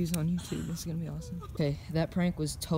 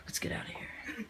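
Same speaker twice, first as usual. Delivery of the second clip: whispering; 6 semitones higher, 11 dB quieter than usual. Real, not acted. Scared, sneaking out.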